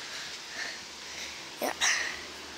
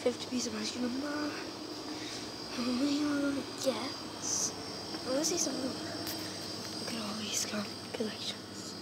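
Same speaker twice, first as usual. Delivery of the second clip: quiet hushed shop whisper; 3 semitones lower, 19 dB quieter than usual. Real, not acted. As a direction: whisper so shoppers don't hear.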